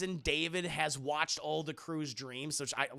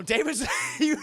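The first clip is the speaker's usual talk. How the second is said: normal talking voice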